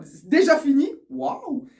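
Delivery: high, loud burst of excitement at a match ending too soon; excited. Pretend. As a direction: gush that it's already over. Acting his own thought during a great match.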